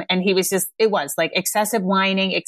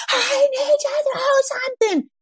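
put-on high, slow wailing child voice; upset child. Parent voicing a child demanding to throw, high slow wail. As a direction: cry it out like a frantic kid. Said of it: "a kid who needs to throw".

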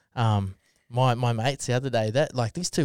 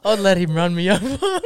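louder, higher, laughing through it; half-laughing mock quote; 7 semitones higher, 8 dB louder than usual. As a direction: repeat it cracking up. Repeating the quote while laughing.

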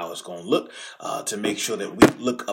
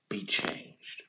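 whispered; 4 semitones lower, 8 dB quieter than usual. Real, not acted. Preacher hushes the congregation on "be changed", whispered. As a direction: drop to a whisper on the last words.

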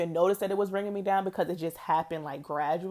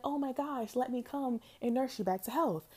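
high, soft, coddling coo, a mock nurse; doting rescuer; 7 semitones higher, 6 dB quieter than usual. To a broken bird on the street, a high soft mock nursing coo. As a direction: coo like you're scooping up a hurt bird. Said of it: "You're like".

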